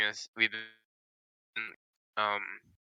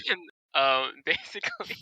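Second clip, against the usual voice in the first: being tickled; 6 semitones higher than usual. Acted.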